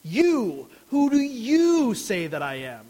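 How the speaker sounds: "You" set apart, then "you" hit hard in "Who do you say that I am?"; preacher punches "you" in Jesus' emphatic question, stressed. Shown as a demonstration. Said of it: "the first word would be you".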